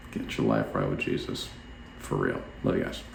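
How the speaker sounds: a low, intimate final plea; quietly sincere farewell; earnest quiet close. Real, not acted. Closing earnest appeal.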